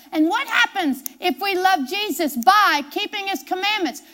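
ordinary delivery

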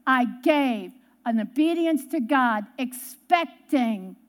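measured, emphatic sermon close; solemn preaching. Real, not acted. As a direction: drive the lesson home slowly, word by word. Preacher's closing point.

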